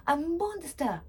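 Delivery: frightened scream. Acted. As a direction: shriek it at the family. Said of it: "I screamed".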